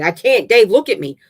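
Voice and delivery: high, very loud outburst; growing hysterical. A narrator's reading. River losing control with Dave, hysterical cry. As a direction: burst out in frantic desperation. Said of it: "growing hysterically".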